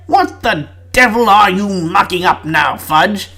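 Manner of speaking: lightly voiced British Prime Minister, brisk; a hint of a posh British leader scolding; British Prime Minister accent. Prime Minister scolding Fudge on a call, mild British accent. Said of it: "how many British accents am I gonna do".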